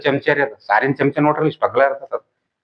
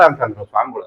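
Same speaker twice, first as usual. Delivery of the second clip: plain reading voice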